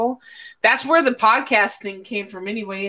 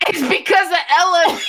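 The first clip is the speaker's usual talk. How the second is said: loud; 8 semitones higher, 4 dB louder than usual. Real, not acted. Blurting the cause excitedly.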